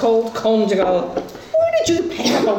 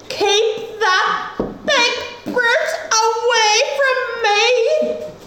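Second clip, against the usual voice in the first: high, slow, shrill cry; high shrieking refusal; 11 semitones higher, much slower than usual. Acted. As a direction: shriek it out from hiding. Rachel shrieks refusal from caravan.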